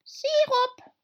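a dozy dormouse piping up; sleepy voice. A narrator's reading. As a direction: mumble it half asleep. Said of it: "sagte eine verschlafene Stimme".

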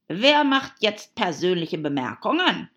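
crowing, loud comeback; triumphant. A narrator's reading. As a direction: throw it back in triumph. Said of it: "fragte der Hutmacher triumphierend".

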